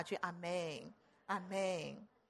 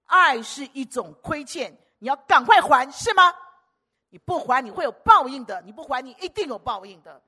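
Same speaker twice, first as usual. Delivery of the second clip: much louder, higher warning sermon; fiery preaching; 4 semitones higher, 14 dB louder than usual. Acted. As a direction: thunder the debt of love. Preaching at full force. (激昂高声讲道)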